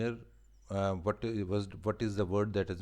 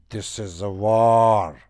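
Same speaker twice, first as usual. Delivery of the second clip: loud, drawn-out declaration of war; theatrical battle cry; 15 dB louder, slower than usual. A narrator's reading. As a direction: cry it out slowly, leaning long and loud on the last word. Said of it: "so I cried".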